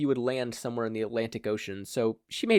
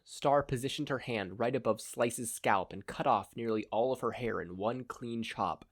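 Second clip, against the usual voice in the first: ordinary delivery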